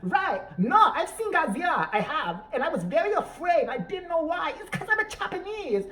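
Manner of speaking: high, hammed-up fake Japanese accent with laughs; mock Japanese accent. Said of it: "as stereotypical and as obnoxious as we can".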